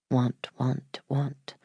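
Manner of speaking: soft fading echo; echoing whisper. A narrator's reading. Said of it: "The word repeated itself".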